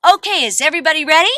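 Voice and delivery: ordinary talk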